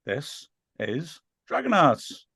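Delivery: slow, drawn-out Wrexham accent announcement; Welsh Wrexham accent. Shown as a demonstration. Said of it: "Said that in a Welsh, Wrexham accent".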